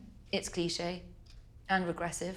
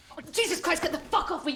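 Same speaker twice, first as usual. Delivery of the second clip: louder, higher get off; angry shout; 7 semitones higher, 5 dB louder than usual. Acted. Woman fighting off her boyfriend, loud angry shout. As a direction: shove him away with words. Pushing him off.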